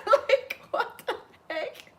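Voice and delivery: high, breathless nervous giggle; nervous laughter. Real, not acted. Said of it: "I'm nervously just laughing".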